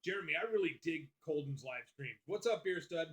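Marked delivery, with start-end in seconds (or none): none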